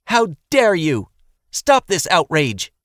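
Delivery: high, loud, slow schoolteacher scolding; piercing teacher voice. A narrator's reading. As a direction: scold them like an outraged teacher. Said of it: "piercing with its metallic teacher notes".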